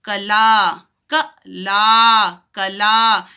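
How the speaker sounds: कला with a short क and a long ला. Shown as a demonstration. Teacher contrasting short क and long ला in कला. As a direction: clip the क, stretch the ला in कला. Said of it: "बहुत छोटा सा आ रहा है".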